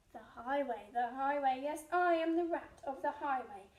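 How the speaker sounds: loud bandit rat demanding treats; thundering rat. Acted. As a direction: thunder it like a robber on horseback. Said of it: "who thundered".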